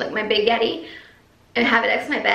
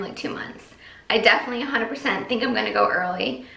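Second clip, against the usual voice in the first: ordinary delivery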